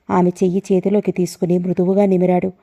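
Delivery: plain reading voice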